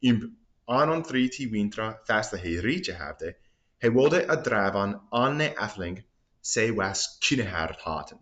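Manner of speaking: put-on Old English reading with rolled vowels. Shown as a demonstration. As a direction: read aloud in Old English, "riche" as "ritche". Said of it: "He wanted to banish".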